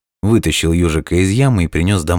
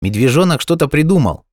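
high, excited call; shouting; 10 semitones higher than usual. A narrator's reading. Hedgehog reassures the hanging hare, calling out. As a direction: call good news upward. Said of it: "крикнул ёжик".

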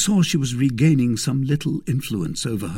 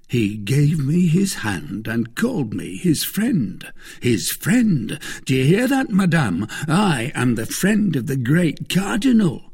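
raised, emphatic, swelling with pride; Bonacieux, boastful husband; 6 semitones higher, 4 dB louder than usual. Acted. Husband boasting to his wife of his great friend, proud. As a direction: boast of it grandly.

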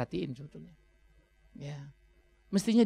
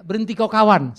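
mock shout; 2 semitones higher, 8 dB louder than usual. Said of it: "cukup teriak".

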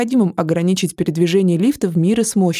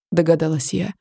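usual speaking voice

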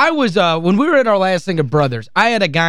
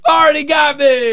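laughing shout; 7 semitones higher than usual. Real, not acted. Host cracking up over his own repeat tickets, laughing shout. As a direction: crack up as you say it.

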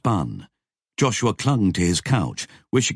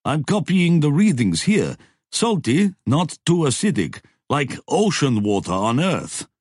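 higher, keen report voice; character voice; 9 semitones higher than usual. A narrator's reading. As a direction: read off the data eagerly, pitched up. Dev reads instrument data.

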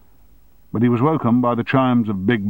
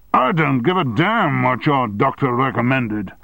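angry retort; 5 semitones higher, 3 dB louder than usual. Acted. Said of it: "said Armstrong".

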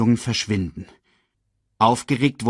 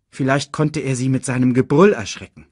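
usual speaking voice

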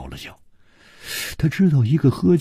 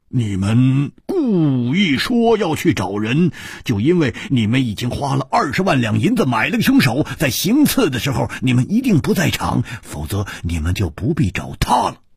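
accusing Hu; 6 semitones higher than usual. Acted. Hu exposing the hired killer scheme, accusing. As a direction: lay out the plot in a raised, pressing voice.

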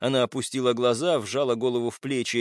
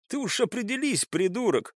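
higher, lightly mocking jibe; smirking; 9 semitones higher than usual. A narrator's reading. Captive taunts his accuser, smirking. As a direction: needle him with a smirk. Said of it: "усмехнулся Олег".

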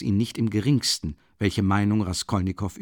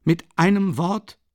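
louder, higher, rising on einem; loud and emphatic; 7 semitones higher, 4 dB louder than usual. Acted. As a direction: stress einem and leave it hanging. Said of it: "sagte er laut und nachdrücklich".

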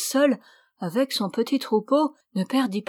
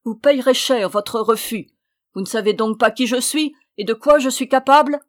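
loud, raised-pitch menace; roaring threat; 4 semitones higher, 7 dB louder than usual. A narrator's reading. Witch threatening the sister who refused, furious. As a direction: threaten furiously, building in force. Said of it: "rugit la vieille".

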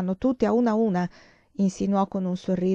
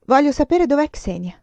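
higher, louder demand; insistent demand; 7 semitones higher, 6 dB louder than usual. Acted. Girl presses the nun about her missing friend, loud. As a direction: insist on an answer. Silvia demands where Xenia is.

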